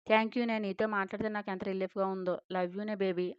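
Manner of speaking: ordinary delivery, nothing marked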